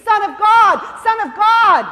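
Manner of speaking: a blind beggar hollering for Jesus, full voice. Acted. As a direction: shout each name over a crowd, straining to be heard. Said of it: "he just hollered all the more".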